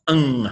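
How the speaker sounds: "ng" as a held nasal "ung"; double gamma sound. Shown as a demonstration. Teacher demonstrates gamma gamma, "ng" as an isolated nasal. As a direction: hum out "ng" on its own. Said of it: "gamma gamma is pronounced".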